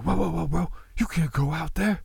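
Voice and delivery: high-pitched hippie protest; high, urgent imitation; mock alarm. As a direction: play a worried hippie stopping a friend. Acts out alarmed hippies warning Draper.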